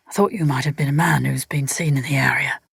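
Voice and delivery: low apologetic whisper; a man whispering an apology to the woman. A narrator's reading. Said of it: "just a whisper, an apology of sorts".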